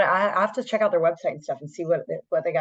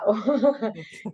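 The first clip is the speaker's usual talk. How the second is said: higher-pitched giggle; real laugh; 3 semitones higher than usual. Real, not acted. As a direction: break into laughter mid-thought. Host laughs while searching for words.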